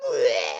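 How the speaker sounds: loud low mock bleh scream. Host imitating a guest who screamed, mock bleh scream. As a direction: blurt out a short mock scream. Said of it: "Wish just like".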